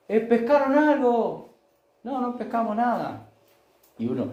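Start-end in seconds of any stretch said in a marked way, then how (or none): 0.1-1.5 high, loud, slow shout across the water; shouting from the shore. Acted. Jesus shouts to the disciples in the boat, loud and high. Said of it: "alguien en la orilla y grita". 2.0-3.3 high shouted reply over the water; call back from the boat. Acted. Disciples answer from the boat.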